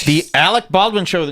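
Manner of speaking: high, dramatic title announcement; announcer voice. Pretend. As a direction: announce it like a TV host.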